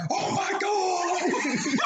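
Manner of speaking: high, drawn-out, laughing Jim Ross-style wail; hammed-up commentator gasp at a crazy move; Jim Ross impression. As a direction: stretch it out like a stunned wrestling announcer. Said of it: "she sounded like Jim Ross from W W E".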